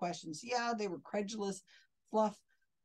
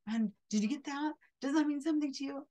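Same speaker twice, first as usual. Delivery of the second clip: higher, louder, quick mocking imitation; mock the medium pressing a crying guest, higher and quick; mocking psychic; 7 semitones higher, 4 dB louder than usual. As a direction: rattle off his probing like a pushy medium. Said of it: "And he's like".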